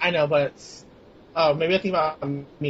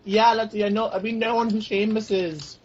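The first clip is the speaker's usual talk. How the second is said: put-on a slower, higher, clumsy Irish accent; bad Irish accent; 4 semitones higher than usual. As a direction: lay on a poor Irish brogue. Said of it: "were you trying to do an Irish accent?".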